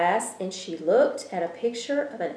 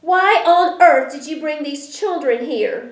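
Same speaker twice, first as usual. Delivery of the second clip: loud, high angry shout at the visitors; 7 semitones higher, 7 dB louder than usual. A narrator's reading. Old woman shouting at Stillwater for bringing children. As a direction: bellow it, furious at the intruders. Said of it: "shouted Missus Whitaker".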